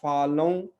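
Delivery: फालों slowly, final vowel hummed through the nose; drawn-out nasal ending on फालों. Shown as a demonstration. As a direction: model फालों with no closed m. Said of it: "नाक में ही रह जाएंगे".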